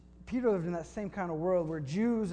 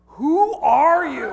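much higher, louder, drawn-out "Who are you?"; astonished; 10 semitones higher, 17 dB louder, much slower than usual. Retold or imitated. Australian father stunned by a stranger's guesses, hammed-up astonishment. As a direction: stretch it out in amazed disbelief.

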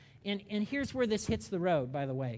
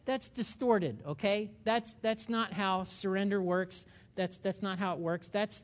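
ordinary talk

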